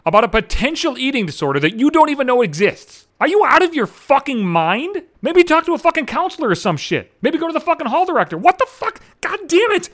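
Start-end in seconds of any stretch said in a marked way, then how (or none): 3.2-9.9 high-pitched, incredulous rant; retold blow-up, pitched way up; exasperated outburst. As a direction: rise to an outraged yelp of protest. Outburst at being asked to intervene.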